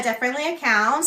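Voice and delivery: ordinary talk, nothing marked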